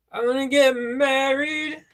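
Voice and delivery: high, slow mock-romantic voice; put-on voice. Reading a fan's message in a mock swooning voice. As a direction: swoon it out slowly like a lovestruck fan.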